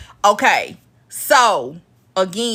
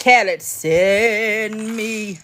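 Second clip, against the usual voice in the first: slow mimicked quote; much slower than usual. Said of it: "he was like".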